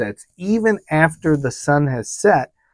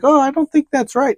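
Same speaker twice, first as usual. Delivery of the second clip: alarmed doubt; 11 semitones higher than usual. Retold or imitated. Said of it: "I'm like".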